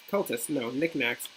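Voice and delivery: ordinary delivery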